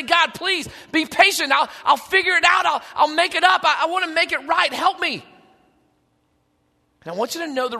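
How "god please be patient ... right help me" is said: high, urgent bargaining plea; desperate pleading. Acted. Sinner bargaining with God for more time, desperate pleading. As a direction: beg in a rising rush of promises.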